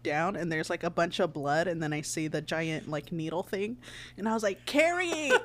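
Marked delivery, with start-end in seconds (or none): 4.6-5.3 high, laughing call of her name. Retold or imitated. Patient calling the nurse, high laughing call. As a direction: call out to the nurse across the room.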